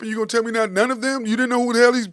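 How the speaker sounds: usual speaking voice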